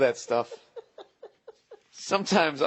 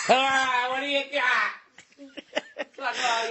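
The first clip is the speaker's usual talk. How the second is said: put-on slurring like a drunk on death row; mock drunk last words; 9 semitones higher than usual. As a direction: play it hammered and defiant. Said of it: "that would be funny if you were hammered".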